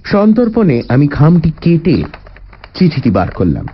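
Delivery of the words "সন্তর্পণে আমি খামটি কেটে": ordinary delivery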